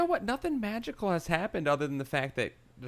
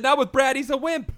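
high, louder restatement; loud repeat; 11 semitones higher, 6 dB louder than usual. Acted. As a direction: say it again louder. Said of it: "What's that? I said".

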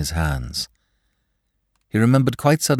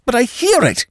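protesting; 5 dB louder than usual. A narrator's reading. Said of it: "protested Peter".

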